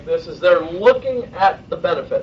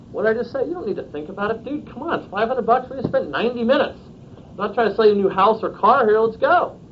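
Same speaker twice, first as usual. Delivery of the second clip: higher-pitched, exasperated buddy-to-buddy push; blunt, direct pushback; 4 semitones higher than usual. Shown as a demonstration. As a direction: press a friend bluntly, no softening. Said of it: "I would say it just like that".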